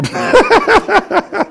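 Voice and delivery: high staccato cackle; joyful laugh. Real, not acted. Preacher laughing at people, high rattling cackle. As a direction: break into a long, high laugh. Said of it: "I'm laughing at people".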